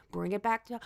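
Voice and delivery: low, hushed calm-down; bringing it down. Real, not acted. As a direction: drop the energy right down.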